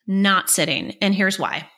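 normal talking voice

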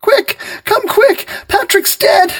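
very high, loud panicked plea; frantic cry. A narrator's reading. Wife crying to the police on the phone, frantic. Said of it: "she cried to him".

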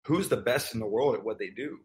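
normal talking voice

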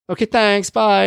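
high, hasty-sounding polite brush-off; awkward goodbye. Retold or imitated. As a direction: escape an awkward moment cheerfully. Acting his awkward exit.

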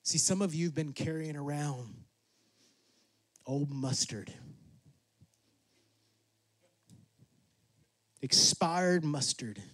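soft, low, hushed sermon close; intimate preaching. Real, not acted. Preacher urging forgiveness, hushed, low and intimate. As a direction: draw the room in quietly.

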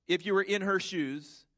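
usual speaking voice

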